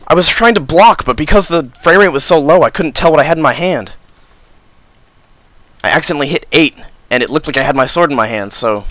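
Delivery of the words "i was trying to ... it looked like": high, loud exasperated rant; frustrated complaint. Real, not acted. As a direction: vent the frustration loudly. Complaining after death.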